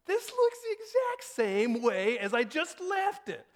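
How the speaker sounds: exasperated disbelief. Retold or imitated. Dad finds house still messy.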